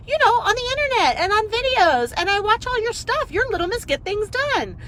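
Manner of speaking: high, loud, breathless gushing; the counter woman explaining she follows her, high gushing; gushing fan voice. Retold or imitated. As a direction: play the starstruck fan. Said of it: "She goes".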